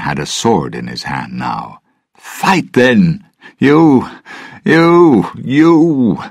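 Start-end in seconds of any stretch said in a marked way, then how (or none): 2.2-6.2 put-on high, loud, slurring drunken yell; drunken shouting. Drunk Norrington challenging the whole bar, loud drunken shout. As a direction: jab out each you at a new target. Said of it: "he shouted drunkenly".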